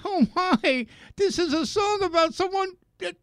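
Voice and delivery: high, mock-scandalized mother; imitating horrified mother. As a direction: pitch it way up in pretend horror. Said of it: "Oh, she was like".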